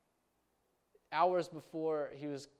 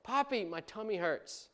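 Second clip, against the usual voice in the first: put-on higher child's voice, a small complaint; child complaining; 6 semitones higher than usual. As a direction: whine gently like a kid with a tummy ache.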